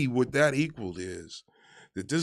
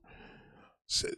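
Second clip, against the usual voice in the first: ordinary talk, nothing marked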